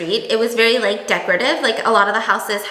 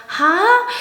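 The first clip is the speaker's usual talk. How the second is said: confused huh; 7 semitones higher, 3 dB louder than usual. Retold or imitated. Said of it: "we go".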